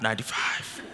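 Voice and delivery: breathy awed whisper; whispered ninety-five; whispered aside. Retold or imitated. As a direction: hush it in disbelief. Whispered repeat of age.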